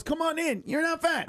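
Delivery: high, breezy invitation; lightly acted friendly passenger; welcoming seatmate. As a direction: wave a stranger in with a lifted voice. Acts welcoming seatmate on plane.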